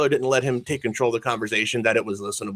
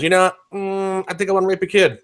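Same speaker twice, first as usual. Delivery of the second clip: mock casual musing; 7 semitones higher than usual. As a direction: sarcastically play someone idly getting an idea. Mocking an absurd casual musing.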